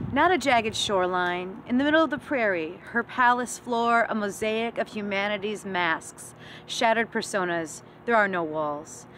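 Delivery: emphatic, measured poem recitation; poetry reading. Acted. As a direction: recite it as a poem, setting each phrase apart.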